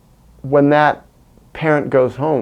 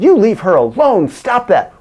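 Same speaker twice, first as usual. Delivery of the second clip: raised, loud command at a stranger; retold shout; 8 semitones higher, 4 dB louder than usual. To a man pushing his girlfriend, loud high shout. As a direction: shout him off her, high and forceful.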